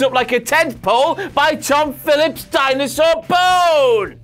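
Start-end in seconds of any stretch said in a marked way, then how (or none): 1.3-4.2 slow, higher shouted punchline. Acted. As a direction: belt out the punchline slowly.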